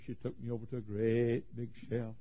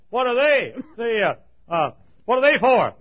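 loud and high, rushing, then a ringing question; preaching at full force; 11 semitones higher, 18 dB louder than usual. Acted. Preacher to his congregation, asking about the ringed men, preaching loudly. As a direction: cry it out over the crowd.

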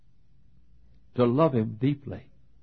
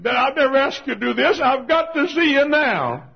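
urgent plea; 8 dB louder than usual. Retold or imitated. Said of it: "He said".

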